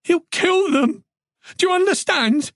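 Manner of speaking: very high, urgent pleading; frantic insistence; desperate panic. A narrator's reading. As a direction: make him understand, voice pitched right up. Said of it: "She needed him to understand".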